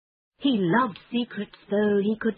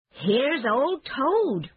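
high, drawn-out happy cry; pleased, swooping high and slow; delighted cry; 7 semitones higher, much slower than usual. A narrator's reading. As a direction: call it out with pleasure. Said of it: "he cried".

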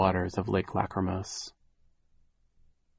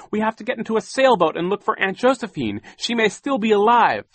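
put-on high, urgent girl's plea; crying out; 13 semitones higher, 5 dB louder than usual. A narrator's reading. Violet pleads to search for her aunt, crying out. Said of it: "Violet cried".

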